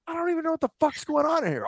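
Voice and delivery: high, bewildered voice; confused. Retold or imitated. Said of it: "I'm like".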